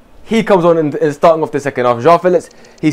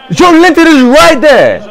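high, slow, furious yell at the screen; drawn-out outraged shout; exasperated yell; 11 semitones higher, 6 dB louder, slower than usual. Real, not acted. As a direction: scream it in disbelief. Exasperated at teammate ignoring pass.

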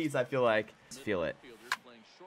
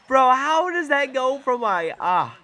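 high, loud incredulous complaint; real frustrated outburst; indignant protest; 11 semitones higher, 6 dB louder than usual. Real, not acted. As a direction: wail it in disbelief at bad luck. Protesting a bad bounce.

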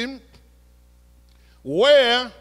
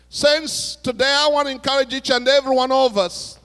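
raised, emphatic preaching to the congregation; 6 semitones higher, 6 dB louder than usual. Acted. Pastor challenging his congregation, preaching with force. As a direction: drive the challenge home from the pulpit.

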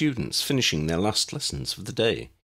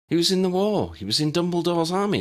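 put-on young girl voice; 10 semitones higher than usual. Tessa explaining to Lydia.

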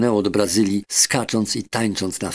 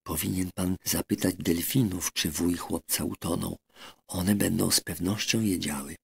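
hushed, much softer aside; whispering duck; 2 semitones lower, 8 dB quieter than usual. Acted. Duck Dab Dab whispering advice behind the doctor's chair, hushed. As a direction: lean in and breathe it to the doctor. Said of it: "i szepnęła".

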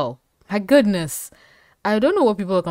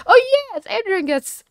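squealing high and loud with delight; fangirl squeal; 6 dB louder than usual. Real, not acted. Cheering for Adrian on screen, high excited squeal. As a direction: squeal like your favorite just spoke.

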